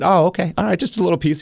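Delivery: high-pitched mock acceptance; sarcastic. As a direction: pretend to buy it, teasingly. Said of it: "sarcastic line of questioning coming his way".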